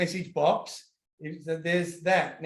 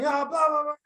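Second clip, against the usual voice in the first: high, mocking whine of a sulky son; whiny kid backtalk; 10 semitones higher than usual. Dad imitating his son's whiny backchat. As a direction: brush it off like a bored kid. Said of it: "If he starts going".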